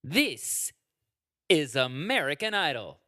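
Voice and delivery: "This", long pause, "is American Idol"; pause after This. Retold or imitated. Mimicking the host's intro with a dramatic pause. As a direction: break after "This" like the host. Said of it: "That's the pause".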